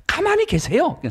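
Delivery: very high, loud shriek to cut him off; shout. Retold or imitated. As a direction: scream him into silence. Wife cuts off pastor again. (목사님 말을 끊으며 아주 높고 크게 버럭 소리치듯)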